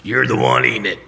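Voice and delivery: lightly imitated old mentor; Lewis Trotter. Lewis delivering his verdict to Harold, mentor's punchline. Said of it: "It's what he sounded like".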